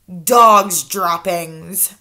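drawn-out and deliberate; slow grim answer. Acted. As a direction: let the words land slowly.

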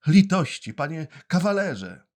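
high, loud desperate plea. Acted. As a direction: beg harder for mercy. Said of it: "zaczął mówić błagalnym tonem".

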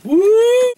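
high, loud falsetto whoop; excited whoop. Real, not acted. Seeing the strawberry middle, a loud high whoop. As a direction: shriek a joyful woo up top.

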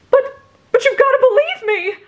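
high, loud desperate wail; despairing wail. Acted. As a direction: beg like all is lost. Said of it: "he wailed in despair".

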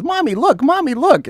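put-on high little-boy voice calling mom; kid voice. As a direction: play the excited five-year-old tugging at mom. Child voiced in retold story.

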